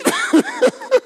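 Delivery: very high-pitched giggles; high laughter. Real, not acted. Preacher laughing at his phone punchline, high giggles. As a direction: burst into real high laughter.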